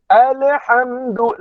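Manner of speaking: alhamdu slow with a bounced l, like alehamdu; common mistake. Shown as a demonstration. Teacher imitates students' mistaken reading. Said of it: "ramai di kalangan kita baca ale".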